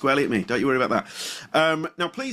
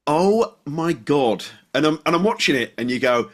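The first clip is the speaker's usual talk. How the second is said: ordinary delivery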